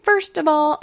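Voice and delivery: high, loud excited squeal; shrieking jackpot winner. Pretend. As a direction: shriek like you just won. Mock award winner celebrating prize.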